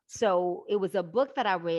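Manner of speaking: normal talking voice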